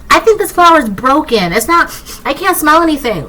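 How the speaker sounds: jealous person griping that the flower is broken, in a high whiny mock voice; whiny mock complaint. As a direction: whine it like a spoiled copycat.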